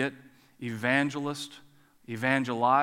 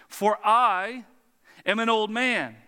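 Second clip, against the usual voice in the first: "I" hit loud and high, set apart by a pause; emphatic I; 4 semitones higher, 4 dB louder, slower than usual. Acted. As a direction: lean hard on "I", then pause. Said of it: "is emphatic".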